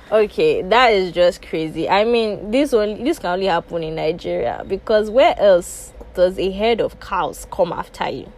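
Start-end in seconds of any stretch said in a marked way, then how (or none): none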